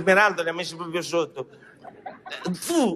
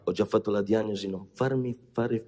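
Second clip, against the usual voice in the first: stern low dismissal; 10 semitones lower, 4 dB quieter than usual. Acted. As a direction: cut him off sternly. Doctor cuts patient off. (secco e grave, basso)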